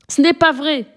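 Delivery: a high cry of denial, not full volume; high-pitched protest, lightly voiced. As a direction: cry out against the giant's words. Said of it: "hurla Margaret".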